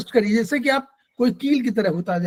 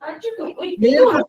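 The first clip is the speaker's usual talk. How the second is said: slow, stretched, higher cue inviting the answer; drawn-out prompt; 7 semitones higher, much slower than usual. Real, not acted. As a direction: draw out मेल का so the student finishes it. Teacher prompts student for answer.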